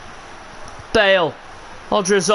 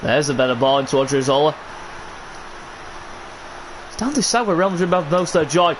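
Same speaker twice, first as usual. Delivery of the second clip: ordinary talk, nothing marked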